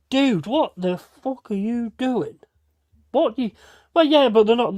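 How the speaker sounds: high, loud, disbelieving shout at a reckless friend; exasperated yell. Retold or imitated. To his mate walking into danger, exasperated high yelling. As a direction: yell at him like he's lost his mind.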